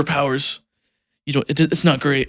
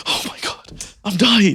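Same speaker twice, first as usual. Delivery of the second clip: overdramatic; 6 semitones higher, slower than usual. Retold or imitated. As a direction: ham up a tiny injury. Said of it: "she's, like, so dramatic".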